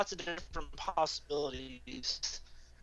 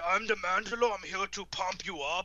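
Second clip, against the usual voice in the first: a put-on Austrian bodybuilder voice, a bit higher; mock accent; 3 semitones higher than usual. Joking about voicing the Mandalore, put-on bodybuilder accent. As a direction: pump the words like a gym coach, hammed up. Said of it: "I put an honored accent".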